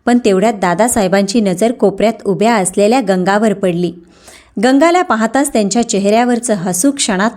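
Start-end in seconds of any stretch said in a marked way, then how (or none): none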